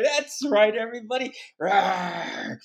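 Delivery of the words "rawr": playful held roar; easy mock roar; bear roar. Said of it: "You're like a bear".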